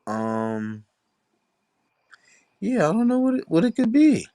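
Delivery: warped high-pitched audio glitch; glitchy distorted audio. Real, not acted. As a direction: audio warping like a bad filter. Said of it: "You're still sounding crazy".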